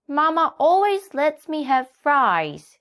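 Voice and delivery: put-on child donkey wheedling; Little Donkey. A narrator's reading. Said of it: "said Little Donkey".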